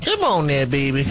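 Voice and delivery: high doting grandma voice. Retold or imitated. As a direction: coax like a loving grandmother. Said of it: "You like my grandma voice?".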